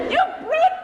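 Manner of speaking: high, outraged pig voice; crying piglet. Acted. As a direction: squeal the insult high. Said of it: "pig cried".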